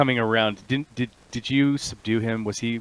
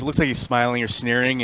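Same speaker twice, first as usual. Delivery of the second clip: ordinary delivery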